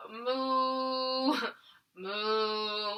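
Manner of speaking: lightly voiced cow moo. Shown as a demonstration. Teacher mooing gently for kids in cow pose. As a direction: give a mock moo. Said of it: "what sound does a cow make?".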